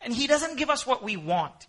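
ordinary talk

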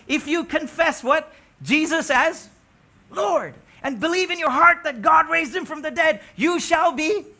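loud, high fervent preaching; full-force sermon shout. Acted. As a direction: preach it out with fire.

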